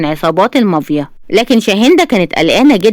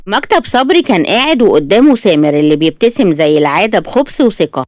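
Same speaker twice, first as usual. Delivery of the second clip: ordinary delivery, nothing marked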